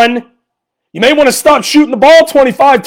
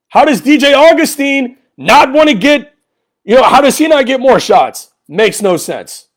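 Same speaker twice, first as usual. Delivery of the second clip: ordinary talk, nothing marked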